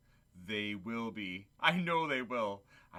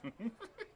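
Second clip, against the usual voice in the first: soft chuckle; 11 semitones higher, 8 dB quieter than usual. Acted. Scrooge chuckles at the boy.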